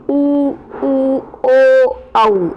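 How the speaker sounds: teacher models उ, ओ, औ one by one for pupils; rounded-lip vowels उ, ओ, औ said clearly apart; vowel demo. Shown as a demonstration. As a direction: sound each vowel उ, उ, ओ, औ on its own, lips rounded. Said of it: "जैसे आप उच्चारण करके देखिए".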